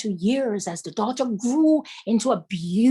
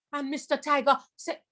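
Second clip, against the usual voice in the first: ordinary delivery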